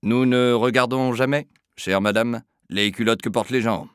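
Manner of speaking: loud, ringing official tone. Acted. Sonorous clerk's reply. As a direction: Filia answering the mother in a ringing voice. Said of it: "d'une voix sonore".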